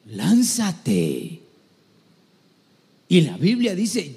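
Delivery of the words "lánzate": low, loud push to act; forceful exhortation. Real, not acted. Preacher urging a first-time prophet to jump in, forceful. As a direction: drive them to take the leap.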